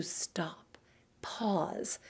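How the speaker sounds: slow hushed whisper; whispered demo of stopping. Shown as a demonstration. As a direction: drop to a whisper to show the pause. Demonstrating the magic pause.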